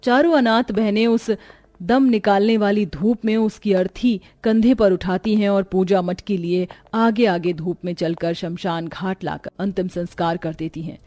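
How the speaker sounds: plain reading voice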